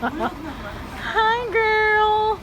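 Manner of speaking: high, bright hello to a friend; excited greeting. Real, not acted.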